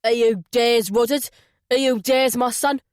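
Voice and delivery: loud hammed-up Cockney; a deliberately bad Cockney accent. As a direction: mangle a Cockney accent on purpose. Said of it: "put on a bad Cockney accent".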